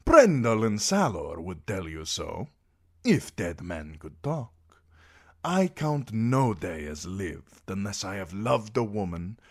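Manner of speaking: sellsword boasting to the queen; flamboyant sellsword. A narrator's reading. Daario voice.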